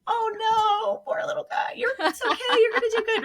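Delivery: very high sympathetic coo; high-pitched, cooing reassurance; pitying encouragement. Retold or imitated. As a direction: fuss over a poor little kid. Pitying, encouraging the little kid.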